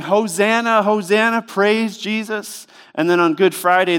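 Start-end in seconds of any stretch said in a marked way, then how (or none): 0.0-2.7 higher, festive crowd cheering, ending slow; mock crowd cheer. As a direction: cheer it like a Palm Sunday crowd. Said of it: "they were yelling".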